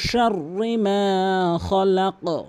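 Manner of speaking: শাররি মা খলাক drawn out very slowly; slow model recitation. Shown as a demonstration. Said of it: "এভাবে বলবেন". (ধীরে তিলাওয়াত)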